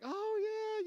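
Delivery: very high squeaky cheer; sing-song girly cheer; little girls cheering. Retold or imitated. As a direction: chirp it like a fifth-grade cheerleader. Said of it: "they got this little cheer going".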